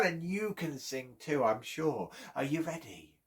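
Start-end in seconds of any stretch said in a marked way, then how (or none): none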